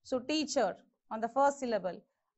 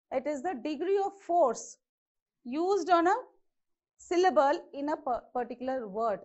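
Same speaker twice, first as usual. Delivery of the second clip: ordinary delivery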